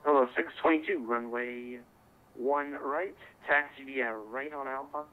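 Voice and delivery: ordinary delivery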